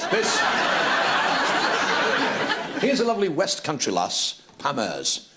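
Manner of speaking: usual speaking voice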